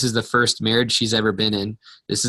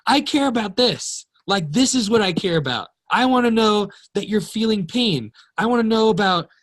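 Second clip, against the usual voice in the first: tearful pleading; 9 semitones higher than usual. Retold or imitated. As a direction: beg to be heard through tears. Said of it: "and I start crying".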